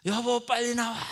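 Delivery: loud, high call to hurry her up; acted husband bellowing to hurry; impatient yell. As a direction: shout to the wife from the car. Husband yelling for wife.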